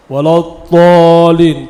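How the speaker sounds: الضالين with a thick emphatic t for ض; heavy ta. Shown as a demonstration. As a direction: show the error, a loud tool in الضالين. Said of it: "طاء مفخمة". (نطق الضالين بطاء مفخمة)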